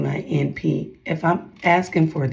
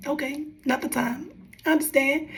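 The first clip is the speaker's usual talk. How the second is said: accepting reply; 10 semitones higher, 5 dB quieter than usual. Retold or imitated. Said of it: "now I'll be like".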